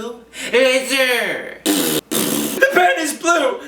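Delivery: high slow put-on voice; slow drawn-out impression; celebrity impression.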